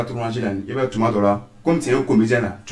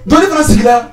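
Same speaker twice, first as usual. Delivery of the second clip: shouted order; 6 semitones higher, 6 dB louder, slower than usual. Acted. Comic sketch, customer in restaurant.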